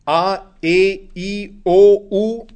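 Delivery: A, E, I, O, U, each vowel said clearly on its own; teacher to pupils, reciting the German vowels clearly; vowels spelled out. Shown as a demonstration. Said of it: "Kennt ihr noch die Vokale?".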